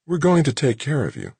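calm him down kindly; soothing. A narrator's reading. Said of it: "she was saying soothingly".

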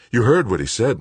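urging. A narrator's reading. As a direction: push him to act. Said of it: "Melissa urged".

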